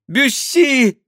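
very high woman's cry; screaming. A narrator's reading. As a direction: cry out the name, high and loud. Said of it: "кричала Диана". (пронзительный женский крик)

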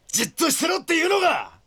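high, indignant shout; furious protest; angry outburst. Acted. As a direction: snap back in fury. Polnareff argues with his allies.

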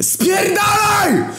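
drawn-out, very high roaring shout. A narrator's reading. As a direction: roar it out, stretched and desperate. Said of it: "Ryknąłem znowu".

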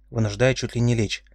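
plain reading voice

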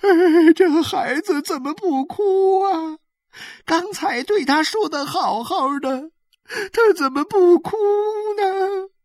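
high, tearful wail; crying. A narrator's reading. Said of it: "她哭着说".

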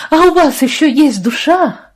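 loud, high-pitched outraged challenge; scornful angry retort. Acted. Лия answers the doctor's confession, scornfully outraged. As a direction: throw it back at them, outraged and loud.

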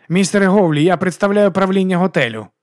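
high, loud formal announcement; hotel official. Acted. Manager confronting a hotel guest, high and officious. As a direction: announce yourself officially, voice lifted.

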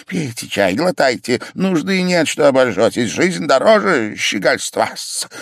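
high, fussy, eager urging; almost rapturous. A narrator's reading. Pavel coaxing the patient to gulp hot tea, excited. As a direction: press it on him with excited insistence. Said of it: "говорил он чуть не в восторге".

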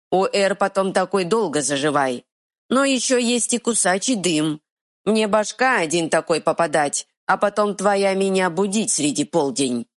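simple troll complaining. Acted. Troll continues.

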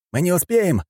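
high-pitched alarmed shout. A narrator's reading. Fighter realizing time is short, shouting. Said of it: "крикнул Фанеров".

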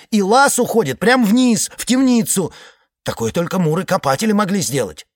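high, loud, agitated telling; excited high report. Acted. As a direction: blurt it out, worked up. Iceman continues report.

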